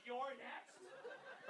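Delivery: barely audible, whispery menace; creepy threat. Acted. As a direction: breathe it out like a horror-film threat. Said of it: "Do you know how fucked up that is".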